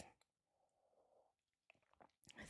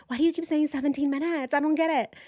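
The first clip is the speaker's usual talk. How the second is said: higher, quicker puzzled voice; mock confused viewer; 8 semitones higher, faster than usual. Host mimicking confused viewers' comments, high and quick. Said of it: "Some of you were like".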